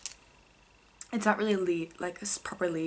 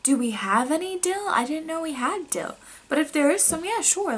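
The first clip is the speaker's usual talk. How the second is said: high, louder, baffled mom voice; taken aback; 9 semitones higher, 5 dB louder than usual. Retold or imitated. Mom startled that her daughter offers dill, high and puzzled. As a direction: imitate mom caught off guard, pitched up. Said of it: "she seems really taken aback".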